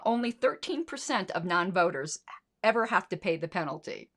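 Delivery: usual speaking voice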